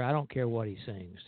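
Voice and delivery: usual speaking voice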